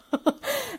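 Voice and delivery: a sudden high peal of laughter. Real, not acted. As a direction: laugh at yourself, high and free. Laughs recalling saying sorry.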